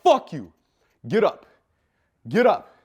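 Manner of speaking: loud, forceful retold outburst; short loud bursts of acted anger; angry challenge. Retold challenge to fight his stepdad.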